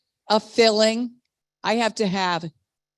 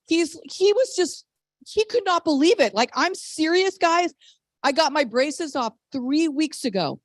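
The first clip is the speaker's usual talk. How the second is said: ordinary talk, nothing marked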